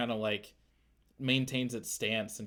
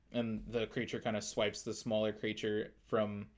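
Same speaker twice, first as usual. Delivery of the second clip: ordinary delivery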